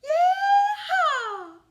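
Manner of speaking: high, loud whooping yeehaw with a laugh; big joyful cowboy whoop. A narrator's reading. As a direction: holler it like a cowboy calling the cattle in. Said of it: "let out his cowboy call".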